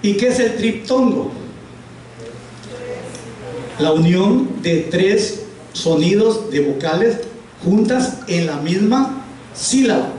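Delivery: ordinary talk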